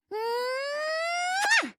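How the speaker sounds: long rising hum into a smack; exaggerated high-pitched kiss sound; big kiss noise. Blowing a stage kiss.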